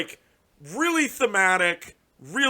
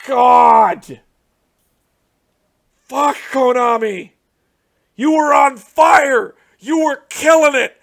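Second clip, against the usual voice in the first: loud, high exasperated yelling; angry shout; 6 semitones higher, 11 dB louder, slower than usual. Real, not acted. Yelling at Konami for ruining the set, loud shout. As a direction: shout the betrayal at them. Berating Konami for bad cards.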